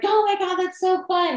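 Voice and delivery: high-pitched mock thrill; excited boyfriend. Boyfriend turns to her after the ride, excited. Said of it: "my boyfriend's all excited".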